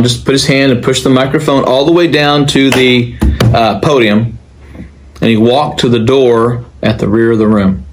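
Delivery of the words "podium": ordinary delivery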